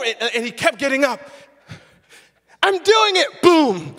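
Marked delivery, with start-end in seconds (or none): 2.6-3.4 put-on high and loud, gleeful kid; triumphant child. Son on the ice calls out proudly, high and loud. 3.4-3.9 big mock crash sound; loud boom. The speaker mimics his son falling on the ice, loud boom.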